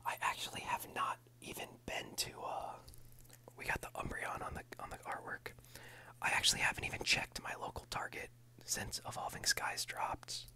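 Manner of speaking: soft close whisper throughout; ASMR whisper. Real, not acted. ASMR host whispering through a pack opening. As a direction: whisper it all for the ASMR channel.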